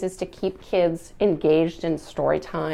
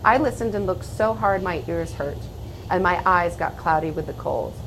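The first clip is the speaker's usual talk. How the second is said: put-on gentle, hushed read-aloud of a children's story; story narration; 4 dB louder than usual. A narrator's reading. Reads a picture book aloud.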